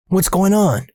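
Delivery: urgent high-pitched demand for answers; alarmed questioning. Retold or imitated. Maintenance man stopping boys with his cart, alarmed. Rudy cuts them off, asks.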